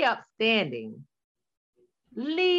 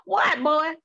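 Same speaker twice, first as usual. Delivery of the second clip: impatient mom; 7 semitones higher, 4 dB louder than usual. Retold or imitated. Mother answers son, acted.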